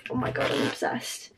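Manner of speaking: near-whispered aside of delight; hushed gushing. Real, not acted. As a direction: drop to a soft, low whisper. Gushing aside about notebook.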